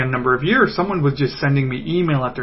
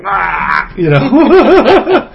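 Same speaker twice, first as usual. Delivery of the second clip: exasperated laugh; 6 semitones higher, 8 dB louder, much slower than usual. Real, not acted. Frustrated exclamation with laugh.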